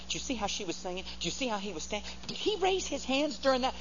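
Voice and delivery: hammed-up judgmental whisper-gossip; gossiping church busybody. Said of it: "gospel Gestapo that run around saying".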